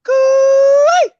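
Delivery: high loud cooee call; hollering in a forest. Shown as a demonstration. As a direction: cooee like you're calling across the bush. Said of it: "Hang on, watch this".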